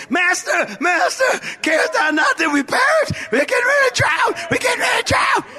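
high, frantic cry for help; hammed-up terrified disciples crying out; panicked plea. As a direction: shout it in rising panic. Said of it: "No, I imagine".

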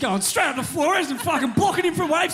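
loud, heckling yell; rowdy shout. Acted. Mock heckling a rival.

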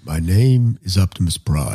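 lightly deep robot hero voice; a hint of Optimus Prime; Optimus Prime impression. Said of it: "Oh, you got it today. That was good".

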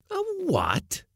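very high, incredulous question; strong amazement. A narrator's reading. Mate to skipper on hearing of a lady cook, amazed. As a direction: shoot the pitch right up, astonished. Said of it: "in tones of strong amazement".

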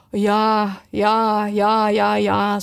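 long drawn-out, monotone sighs; resigned, weary string of yeses; sighing. A narrator's reading. Griffin sighing in turn over the old teacher, wistful. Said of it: "op zijn beurt zuchtend".

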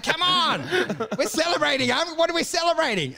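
high-pitched, coaxing party enthusiasm; mock revelry. Reveller to strangers after taking their champagne, high mock revelry. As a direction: urge them on in a high voice.

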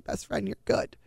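soft, breathless and tearful; choked whisper. Retold or imitated. Mom reassuring her daughter she never gave up, in a choked whisper. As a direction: barely get it out, comforting her.

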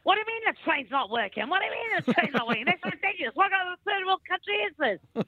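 high-pitched indignant rant; mock outrage. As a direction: complain like an exasperated British rail passenger, hammed up. Acting out an irate train passenger.

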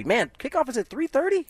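high, rising surprised question. Real, not acted. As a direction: sound caught off guard by it. Reacting to the kickoff time.

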